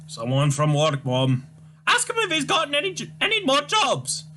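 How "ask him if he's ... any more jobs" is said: put-on high, wailing, scolding old mother voice; shrill old Scottish mum. Mother wails at son Tavish, high despairing scold. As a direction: play the despairing mother crying to the Lord. Mother calls from another room.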